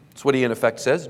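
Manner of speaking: ordinary talk, nothing marked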